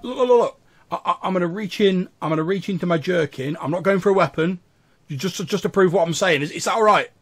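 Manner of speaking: high, hurried nervous stammer; anxious pleading. Acted. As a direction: plead not to be attacked. Scared captive asks before reaching in.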